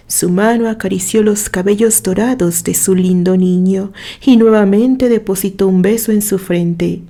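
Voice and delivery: plain reading voice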